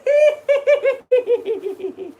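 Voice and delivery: loud high giggle; high cackle. Acted. Laugh after greeting.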